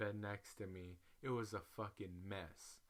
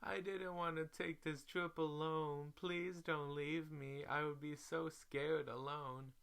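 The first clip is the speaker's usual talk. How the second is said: mock crying plea; 6 semitones higher than usual. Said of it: "she starts crying".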